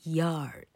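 yard slowly, starting on a clear y glide; careful, lengthened yard for learners; model pronunciation. Shown as a demonstration. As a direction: demonstrate the y that opens yard. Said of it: "老柯念给你听哦".

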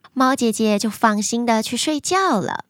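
plain reading voice

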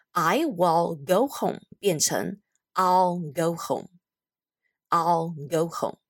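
slow, careful "I'll" with a full L; slow contraction demo. Shown as a demonstration. Teacher slowly modelling the short form "I'll". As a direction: stretch out the contraction. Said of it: "简短的版本".

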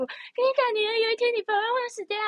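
high, sing-song parent lecturing daughter; mock parent voice. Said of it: "被我爸妈灌输".

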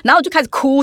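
usual speaking voice

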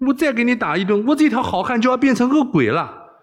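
high, put-upon protest; wry complaint. A narrator's reading. Wei Xiaobao dreading another beating, wryly rueful. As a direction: bemoan your fate up high, half joking. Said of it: "韦小宝苦笑道".

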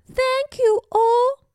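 very high little-bird voice; grateful small bird. Acted. Small bird thanks everyone.

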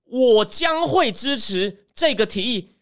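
slow, drawn-out words, leaning on 会 in 我将会支持; hesitant, stalling. Retold or imitated. Spy chief stalling his answer to Putin, slow. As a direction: drag 我将会支持这个提议 out reluctantly. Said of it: "讲吞吞吐吐".

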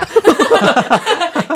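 high burst of laughter; loud laugh. Real, not acted. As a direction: crack up loudly. Bursts laughing after her demo.

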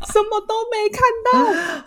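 high, slow, laughing lament; laughing mock complaint. Still retelling the complaint about seeing nothing, laughing. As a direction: whine it out through laughter.

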